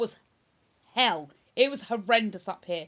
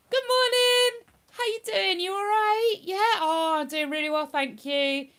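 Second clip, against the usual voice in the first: masking cheerful face; 8 semitones higher than usual. Acted. Streamer showing viewers the everyday mask, falsely cheery. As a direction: put on a bright front to hide depression. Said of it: "you'll see this face all day".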